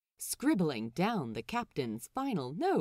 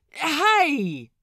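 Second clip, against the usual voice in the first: sharp loud yell, much higher; shout; 7 semitones higher, 11 dB louder than usual. Acted. Cook yelling at a man rushing in, loud shout. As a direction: bark a warning. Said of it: "Roach shouts".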